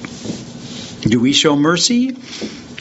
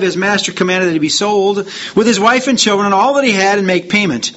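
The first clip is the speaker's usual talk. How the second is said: raised, fast scripture reading; 4 semitones higher, faster than usual. Acted. Preacher reading the parable to the congregation, raised and fast. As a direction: read the parable out with rising fervour.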